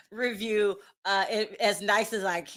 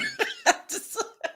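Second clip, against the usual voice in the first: high, voiceless laughter; breathy laughing; 10 semitones higher, 3 dB quieter than usual. Real, not acted. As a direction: dissolve into laughter. Laughs at chat's nickname.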